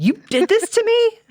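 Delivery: high, squealing mock outrage; mock outraged kid. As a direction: play the betrayed son, going way up. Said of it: "He was so mad".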